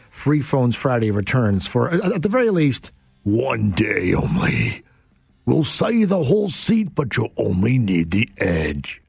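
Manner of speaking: monster truck ad. Shown as a demonstration. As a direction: stretch it out like a truck rally promo. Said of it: "how is that for my, my monster truck voice guy".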